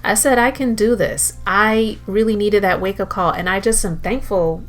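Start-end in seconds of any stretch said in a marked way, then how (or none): none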